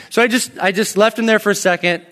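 ordinary talk

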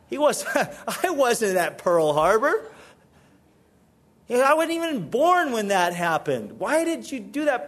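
exasperated man pleading his innocence; indignant protest. Acted. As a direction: argue you weren't even there. Chinese guy protests the punch.

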